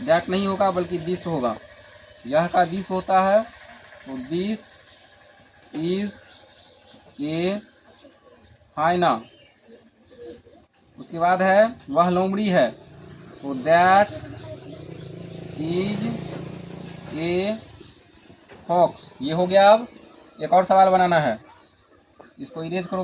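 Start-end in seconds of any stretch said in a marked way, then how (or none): 4.1-9.2 slow dictation. Shown as a demonstration. Teacher dictating to class. 13.5-18.9 slow dictation. Shown as a demonstration. Teacher dictating to class.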